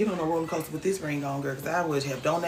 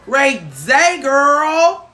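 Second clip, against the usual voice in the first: excited greeting; 8 semitones higher, 11 dB louder, much slower than usual. Real, not acted. Greeting again.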